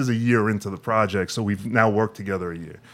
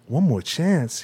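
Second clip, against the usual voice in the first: incredulous; 6 semitones higher, slower than usual. Retold or imitated. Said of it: "his intonation went up".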